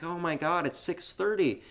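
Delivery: lightly acted mom, pitched high; mock dismay at being woken early; woken-up mother. Host as mother woken by her son, high mock exasperation. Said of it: "And she's like".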